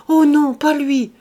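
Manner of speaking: high, loud protest; crying out. A narrator's reading. Servant denying her master's guess, crying out. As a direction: exclaim the denial in alarm. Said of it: "Elle s'écria".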